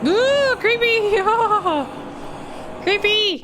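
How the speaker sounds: playful high-pitched creeped-out squeal; mock creepy squeal. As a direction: squeal it in a silly falsetto. Reacting to monster reveal.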